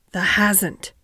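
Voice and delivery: usual speaking voice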